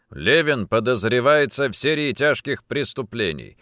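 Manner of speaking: ordinary delivery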